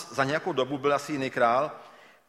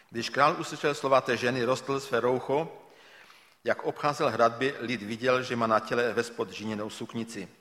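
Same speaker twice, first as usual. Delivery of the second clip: scripture reading; 3 semitones lower, 4 dB quieter than usual. Acted. Reads scripture passage aloud.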